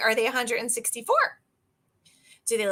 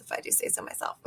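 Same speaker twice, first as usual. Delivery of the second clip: whispered, a little sly self-praise; whispered aside; 13 dB quieter, faster than usual. Real, not acted. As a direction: whisper it to yourself, pleased.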